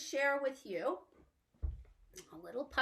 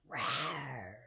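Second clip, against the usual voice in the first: low growled rawr; lion roar; 10 semitones lower, 7 dB quieter than usual. Pretend. Teacher growling a lion roar for children.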